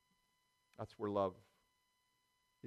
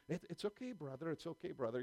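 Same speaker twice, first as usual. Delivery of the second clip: put-on a light Scottish accent, gently reassuring; 3 semitones higher, 6 dB quieter than usual. Tall Scottish preacher reassuring the cramped host, light Scottish accent. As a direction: lightly imitate the Scottish preacher being gracious. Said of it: "you know, with a Scottish accent".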